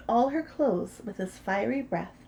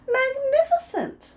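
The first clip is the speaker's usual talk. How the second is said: high, loud and slow magnificent; mock praise; 7 dB louder than usual. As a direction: flatter him all the way up. Princess praises dragon again.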